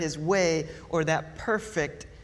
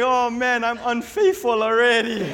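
amused inner panic; 4 semitones higher, 6 dB louder than usual. Retold or imitated. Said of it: "I'm thinking".

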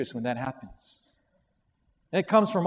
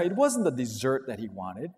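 usual speaking voice

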